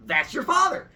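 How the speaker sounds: almost shout it at him; exasperated insistence. Acted. Mom repeating herself to her confused son, exasperated. Mother insisting, pointing at sky.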